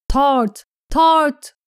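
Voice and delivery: high, rising rallying cry; shouted battle cry. A narrator's reading. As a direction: cry the house name as you charge. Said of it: "diye bağırdı Brienne".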